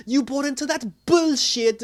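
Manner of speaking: loud, high-pitched outraged quote; mock ballistic. Villain confronts hero in his new costume, mock ballistic. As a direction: imitate her blowing up, voice jumping high. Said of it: "she went ballistic".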